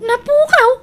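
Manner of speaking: a sharp, incredulous question; disbelieving echo. Acted. Hears an item was lost.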